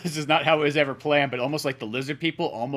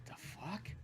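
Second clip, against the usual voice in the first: whispered; 4 semitones higher, 20 dB quieter than usual. Retold or imitated. Kid reaction, whispered.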